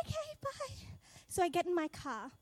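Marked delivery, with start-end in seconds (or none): 0.1-0.9 high, soft confused bye; deflated goodbye. Retold or imitated. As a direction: left hanging, let down. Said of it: "And I'm like".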